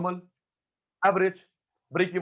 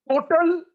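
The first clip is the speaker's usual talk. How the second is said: Total punched high and loud; stressed word; 12 semitones higher, 7 dB louder, much slower than usual. Shown as a demonstration. Teacher stresses total for students, punched high. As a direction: hit total hard.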